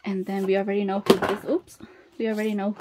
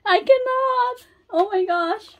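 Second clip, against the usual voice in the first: overwhelmed; 12 semitones higher, 3 dB louder than usual. Real, not acted. Fan reacting to a card pull, high overwhelmed squeal.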